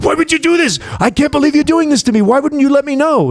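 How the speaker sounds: high-pitched imitated pleading; mock protest. Retold buddy's upset protest to [name].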